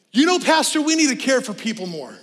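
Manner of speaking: lightly voiced member pitching an idea; mock churchgoer suggestion. Churchgoer nudging the pastor, lightly mocked. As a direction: sell it like a helpful church member. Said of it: "the symptoms kinda sound like this".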